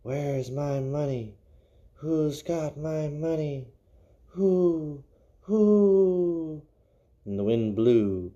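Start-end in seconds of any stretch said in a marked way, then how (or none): 0.0-6.6 high, wailing ghost calling from far off; eerie ghost wail, climbing higher; ghostly wailing voice. Acted. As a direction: moan it out like a dead woman hunting her money. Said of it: "It was a voice crying".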